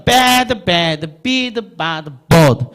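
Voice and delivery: bad, bad, bid, bad, bird chanted with stretched vowels; rhythmic teacher's run of bad, bad, bid, bad, bird; pronunciation drill. Shown as a demonstration. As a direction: step through bad, bad, bid, bad, bird as bad, bed, bid, bahd, bod. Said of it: "발음은 연습시키는 거예요".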